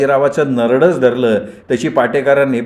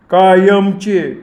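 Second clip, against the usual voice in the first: very slow, higher, drawn-out word; ominous gang boss; 7 semitones higher, much slower than usual. Acted. Gani telling Sambhajirao he needs the boys forever, ominously slow. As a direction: let the word hang like a threat.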